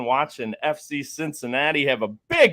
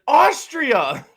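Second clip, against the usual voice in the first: much higher, loud proud yell; excited shout; 8 dB louder than usual. Retold or imitated. Quoting the movie's mixup to his cohost, excited high shout. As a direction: blurt the country out triumphantly. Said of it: "he's like".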